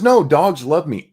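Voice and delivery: mock cocky stranger; overconfident gas man. Gas man dismissing the owner's warning, mock confident.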